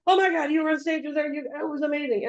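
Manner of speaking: high, fast, thrilled gushing; gushing fan excitement. Retold or imitated. Fans stopping her at Comic Con, high thrilled gushing. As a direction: gush like a starstruck fan stopping them. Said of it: "people coming up and be like".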